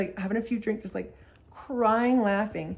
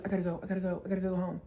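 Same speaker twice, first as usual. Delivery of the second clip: 1 anxious mumbling; 8 dB quieter, much faster than usual. Retold or imitated. Friend anxious, checking his watch.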